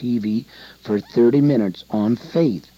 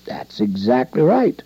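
ordinary talk

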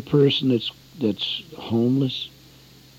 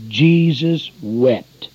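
slow solemn reading; 3 semitones higher, 4 dB louder, much slower than usual. Acted. Reading the shortest verse slowly.

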